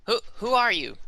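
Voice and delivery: high, stammering goblin question; jittery creature asking; nervous goblin. Acted. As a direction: squeak it out like a wary goblin. Goblin challenges the party.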